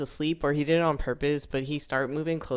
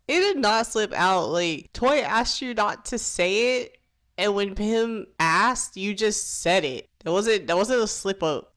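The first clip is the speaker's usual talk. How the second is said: higher, louder indignant scolding; exasperated rant; 6 semitones higher, 4 dB louder than usual. Real, not acted. Vlogger scolding Matt for outing his friend, indignant. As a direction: tell him off in disbelief.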